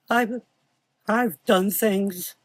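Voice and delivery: put-on high, slow sobbing boy. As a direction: sob it out. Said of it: "he said with a sob".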